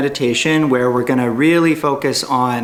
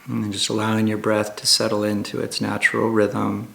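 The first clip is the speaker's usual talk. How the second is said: calm, hushed meditation guide with long pauses; guided meditation; 4 semitones lower, 5 dB quieter than usual. Acted. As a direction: ease listeners gently into stillness, unhurried and even.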